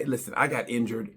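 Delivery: ordinary talk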